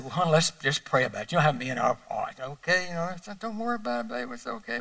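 calm her down gently, almost hushed; soothing. Retold or imitated. Husband comforts his crying wife, soft and soothing.

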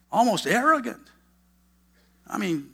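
ordinary talk, nothing marked

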